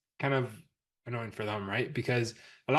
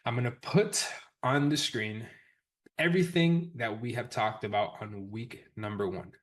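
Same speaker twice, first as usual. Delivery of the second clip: normal talking voice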